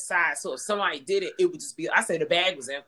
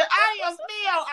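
high, laughing through a mock scream; 13 semitones higher, 3 dB louder, much slower than usual. Retelling the theater lady's scream, laughing high. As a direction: squeal it out while cracking up. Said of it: "She said".

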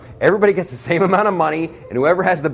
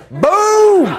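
loud, much higher shout of boom; triumphant boom; 6 dB louder than usual. Acted. Comic bragging America picked Earth's leader, loud boom. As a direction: slam it down like a victory cry.

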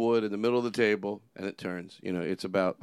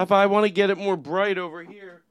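loud, higher demonstrator's patter; infomercial pitch; 5 semitones higher, 7 dB louder than usual. Acted. Host demonstrating a product, loud infomercial pitch. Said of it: "those bad infomercials".

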